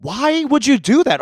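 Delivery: scolding mom for littering, indignant. Retold or imitated. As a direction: scold her in disbelief. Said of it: "And I was like".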